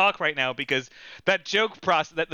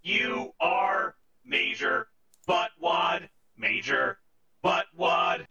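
very slow, drawn-out computer voice; a robot hurling a silly insult; much slower than usual. Acted. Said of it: "All right, Compubot".

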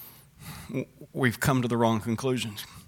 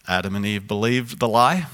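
ordinary delivery

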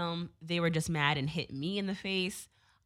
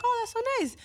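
squeaky, much higher mock-gushing thank-you; touched and sweet; faster than usual. Thanking the artist for cancelling the tour, high gushing. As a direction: gush it way up high like you're melting.

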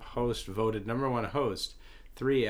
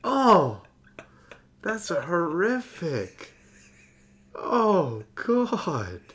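loud, high disgusted groan; 9 semitones higher, 7 dB louder, much slower than usual. Real, not acted. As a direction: recoil in horror, loud and high. Reacting to surgery scars.

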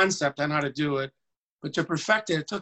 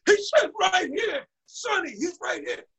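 high excited shout; excited repeat. Retold or imitated. Teller re-shouting Danny's words on the phone, high and excited. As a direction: shout it again, thrilled. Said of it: "Just like in the movie".

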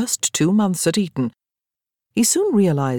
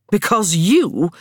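put-on slow, louder drunken pointing; tipsy accusation; 3 semitones higher, 4 dB louder, slower than usual. A narrator's reading. Daniel drunkenly accusing Hugh, slow and loud. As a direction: draw it out while wagging a finger.

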